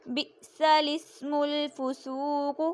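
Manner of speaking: بئس الاسم الفسوق slowly recited with a zer on the lam; slow careful recitation; Quran recitation. Shown as a demonstration. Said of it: "لام کو زیر دے کر پڑھیں".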